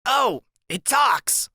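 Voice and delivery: put-on high, slow, amazed little boy voice; high childlike astonishment; Freak's voice. A narrator's reading. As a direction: gasp it out like a tiny kid in wonder. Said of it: "and he goes".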